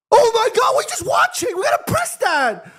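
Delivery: very high, strained cries of disbelief; despairing yell. Real, not acted. Fan in disbelief at his team just watching, strained high yell. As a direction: shout in exasperation at the team.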